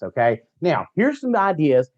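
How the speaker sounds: usual speaking voice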